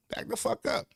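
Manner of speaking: high, breathy mock protest; imitated kid's whispered back-talk; whispered retort. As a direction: hiss it under the breath. Child's inner reply, whispered.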